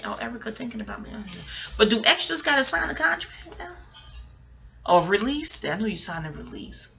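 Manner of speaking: usual speaking voice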